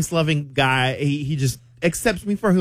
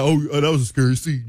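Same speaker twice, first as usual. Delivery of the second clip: lower, quick, mock-casual man; playing it manly; 4 semitones lower, faster than usual. Embarrassed screamer plays it off to the theater, mock manly. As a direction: brush it off with feigned cool. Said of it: "I'm a man".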